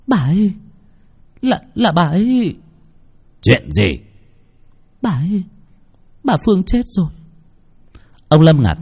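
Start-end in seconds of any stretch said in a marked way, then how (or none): 0.1-7.1 much higher, halting and scared; struggling to say someone died; shaken stammer. Acted. Daughter stammers news of death.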